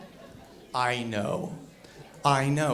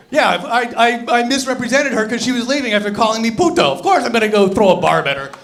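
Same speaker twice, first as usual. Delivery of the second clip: usual speaking voice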